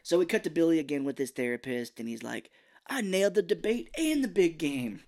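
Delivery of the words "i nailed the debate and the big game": high, put-on bragging voice; boastful teen. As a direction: crow about a win, pitched well up. Imitating Billy boasting to therapist.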